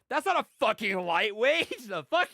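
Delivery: imitating a loud, slow, high shocked yell; shocked outburst. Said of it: "Aaron's like".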